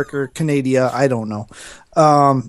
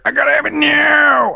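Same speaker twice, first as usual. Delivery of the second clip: hammed-up "gimme" wail; greedy gamer; 12 semitones higher than usual. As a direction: play an impatient, grasping consumer. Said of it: "Will that greed factor".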